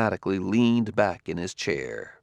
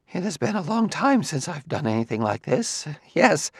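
put-on lightly raised, awkward old sociologist; hesitant recluse, a touch higher; a hint of a nervous scholar; Quemot's voice; 7 semitones higher than usual. A narrator's reading.

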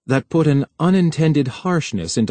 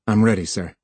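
ordinary delivery

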